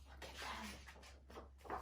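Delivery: slow breathless whisper. Acted. Teacher to her class, slow awed whisper. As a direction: whisper as if not to disturb them. Hushed wonder at dancing monsters.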